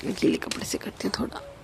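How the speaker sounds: soft whispered aside; quiet murmur to herself. Real, not acted. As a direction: breathe it out under your breath. Quiet aside while cleaning.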